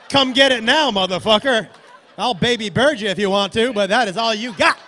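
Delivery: loud, cocky taunt; defiant challenge. Acted. Smuggler daring officials to come get the edible, loud defiance. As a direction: dare them at full volume.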